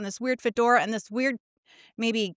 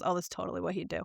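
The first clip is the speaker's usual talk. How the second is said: much softer, lower, offhand roommate voice; unbothered roommate; 4 semitones lower, 10 dB quieter, much faster than usual. Retold or imitated. Roommate calmly dismissing her outrage, soft and low. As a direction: shrug it off like it's nothing new.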